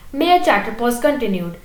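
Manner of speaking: plain reading voice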